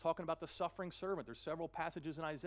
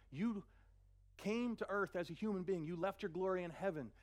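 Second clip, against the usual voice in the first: ordinary talk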